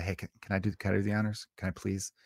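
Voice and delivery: softly asking the bartender to open it; hushed, eager little request; polite ask. Retold or imitated. Asking the bartender to let him open the beer, hushed. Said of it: "but I would love if I could say".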